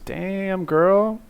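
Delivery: high, drawn-out mock swoon; playful admiration. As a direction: stretch it upward into a loud, teasing compliment. Playfully gushing over a commenter.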